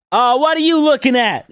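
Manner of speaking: imitated confrontation. Woman heckling people walking by, high mock holler. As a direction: call out a passer-by as the alley woman.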